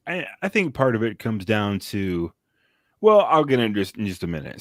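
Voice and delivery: ordinary talk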